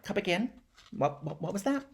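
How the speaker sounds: high, soft, puzzled double-take; acted disbelief. Kid hearing who friend's dad is, softly incredulous. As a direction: stammer it high and quiet, rising at the end.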